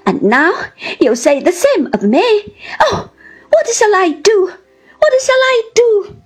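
tearful wail rising high; sobbing. A narrator's reading. Wife despairing at her husband's words, sobbing wail. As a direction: build from hurt reproach to a high helpless wail. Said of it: "sobbed Dora".

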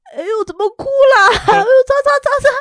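hammed-up panicky sorry; fussing husband. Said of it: "husband apologising".

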